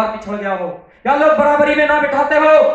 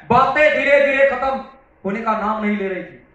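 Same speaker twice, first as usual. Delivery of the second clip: ordinary talk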